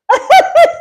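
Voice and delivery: laugh. Real, not acted. Laughing at her own retort.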